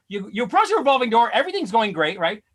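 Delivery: normal talking voice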